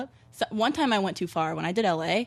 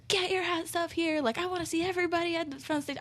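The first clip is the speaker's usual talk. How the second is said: mild mock shout; 9 semitones higher, 5 dB quieter than usual. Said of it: "So I'm shouting at her".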